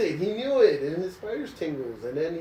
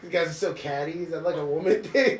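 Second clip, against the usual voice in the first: ordinary talk, nothing marked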